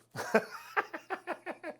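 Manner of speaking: squeaky breathless giggle; helpless high-pitched giggle fit; high wheezy laugh. Real, not acted. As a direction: laugh up high, mostly air. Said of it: "I can't get through that one without laughing".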